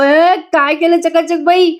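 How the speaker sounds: high, drawn-out comic cry; mock yell. Jiva yelps after she slaps his ear, comic mock yell. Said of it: "जीवा जोरात ओरडतो आणि म्हणतो".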